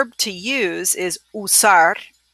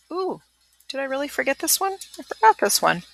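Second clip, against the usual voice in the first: surprised; 7 semitones higher than usual. Real, not acted. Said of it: "surprised at own mistake".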